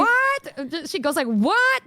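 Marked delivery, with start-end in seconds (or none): 0.0-0.9 high, rising shocked "What?". Retold or imitated. Imitating Michi's shocked reaction to her girlfriend. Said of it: "one of my favorite Michi reactions". 1.3-1.8 high, rising shocked "What?". Retold or imitated. Imitating Michi's shocked reaction to her girlfriend. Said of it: "one of my favorite Michi reactions".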